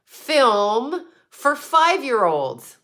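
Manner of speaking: slow, deliberate, each word weighed; slow knowing delivery; careful coded talk. Real, not acted. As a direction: spell it out slowly so the hint lands. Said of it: "I have to talk like this".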